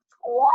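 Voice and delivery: high, loud squawk; startled goose squawk. A narrator's reading. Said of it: "went the goose".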